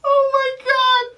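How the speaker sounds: high squealing laugh; cracking up helplessly; laughing hard. Real, not acted. Rewatching scene, bursts out laughing.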